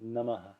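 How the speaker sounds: softly said Namaha with a light final ha; quiet model of "Namaha". Shown as a demonstration. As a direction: let the ha of Namaha be a short, soft echo. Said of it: "is the correct pronunciation".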